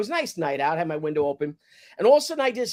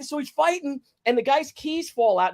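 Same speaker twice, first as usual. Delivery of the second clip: ordinary talk, nothing marked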